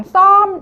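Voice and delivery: 썸 punched high and loud; stress on 썸. Shown as a demonstration. Teacher stresses the second syllable for learners. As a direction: hit 썸 hard and high. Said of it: "두 번째 썸에 강세를".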